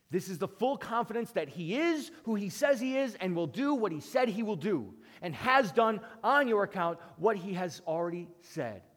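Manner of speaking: normal talking voice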